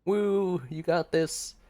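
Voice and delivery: high, loud cheer for a friend; root for her from the sidelines; excited whoop of support. Real, not acted. Cheering on the game contestant.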